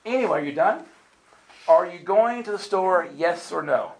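exasperated wife pushing for yes or no; impatient wife. Retold or imitated. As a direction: cut him off and demand an answer. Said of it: "She goes".

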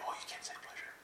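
scandalized whisper, imitated; mock hushed disapproval. Imitating churchgoers who forbid pleasure, mock scandalized whisper. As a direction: whisper it as if the word were forbidden.

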